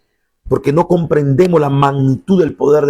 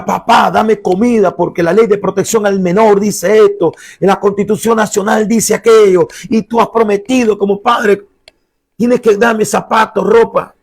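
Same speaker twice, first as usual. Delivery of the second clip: higher, insistent list of demands; demanding child's complaint; 6 semitones higher than usual. Acted. Son demanding food from his father in public, insistent complaint. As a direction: reclaim your rights, pitched up and pressing. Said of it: "que tu hijo te reclame por las leyes".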